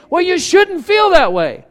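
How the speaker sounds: dismissive husband. Retold or imitated. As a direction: wave the feeling away. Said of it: "who are we to say".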